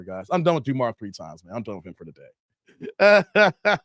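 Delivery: ordinary talk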